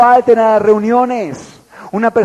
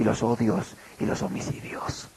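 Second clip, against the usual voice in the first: whisper; 9 semitones lower, 14 dB quieter than usual. Real, not acted. Preacher listing sins of the heart.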